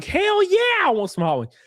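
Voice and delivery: answering the DoorDash ad with excitement; eager yes. Retold or imitated. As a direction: jump at the offer of hot wings. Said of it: "And I'm like".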